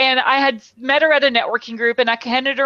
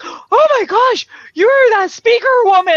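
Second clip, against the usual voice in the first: high, louder starstruck gasp; excited fan; 10 semitones higher, 5 dB louder than usual. Retold or imitated. Airport stranger recognizing the speaker, excited and high. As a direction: gush like a thrilled stranger. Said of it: "He's like".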